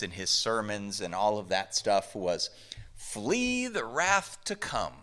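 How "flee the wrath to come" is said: put-on slow, higher-pitched southern accent. Preacher quoting Wesley's catchphrase to the congregation, southern accent. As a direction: stretch it out with a hint of Southern accent. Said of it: "said that in a southern accent".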